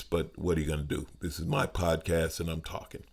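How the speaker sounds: ordinary talk, nothing marked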